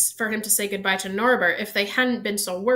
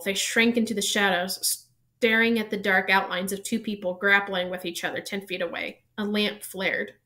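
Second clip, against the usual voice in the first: plain reading voice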